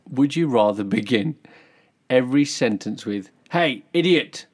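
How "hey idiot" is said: 1 cheeky insult. Pretend. Quoting rude greeting.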